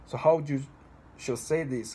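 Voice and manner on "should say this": usual speaking voice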